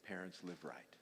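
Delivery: low, soft, sincere appeal; quiet earnest plea. Real, not acted. Preacher begging parents to live right, soft and sincere. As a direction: plead gently with parents.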